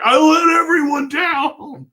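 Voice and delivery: high, loud tearful wail; imitated crying despair. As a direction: cry it out as if the whole world is lost. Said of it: "why these emotions are pouring out".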